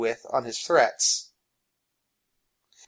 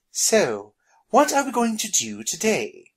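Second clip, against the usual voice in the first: eager question; 8 semitones higher than usual. Acted. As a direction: lift the pitch in an eager question.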